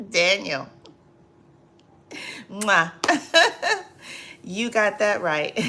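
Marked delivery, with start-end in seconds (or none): 3.0-3.8 laughing. Real, not acted.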